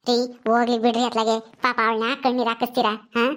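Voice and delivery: put-on soothing older mother. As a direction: hush her gently like a village mother. Mother figure pleads for daughter.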